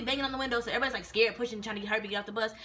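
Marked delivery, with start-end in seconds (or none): none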